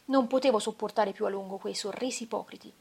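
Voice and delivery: usual speaking voice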